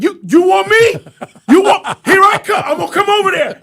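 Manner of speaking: loud, high hammed-up challenge; mock shouted taunt. Host voicing the dog challenging the deer. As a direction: yell it like a cocky brawler. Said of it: "He's like".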